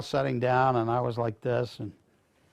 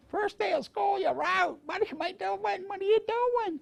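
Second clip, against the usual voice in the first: much higher, exasperated mother's voice; upset mother. Retold or imitated. Mother scolding him at home.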